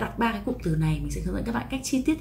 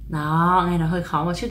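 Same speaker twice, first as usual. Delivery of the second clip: usual speaking voice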